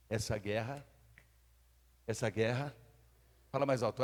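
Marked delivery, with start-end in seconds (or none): none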